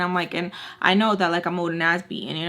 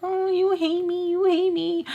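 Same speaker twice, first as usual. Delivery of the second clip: mocking whine; 10 semitones higher, slower than usual. Mocking what the other person said.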